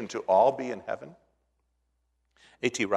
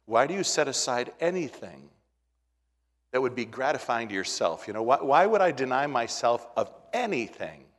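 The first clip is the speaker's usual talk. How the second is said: ordinary talk, nothing marked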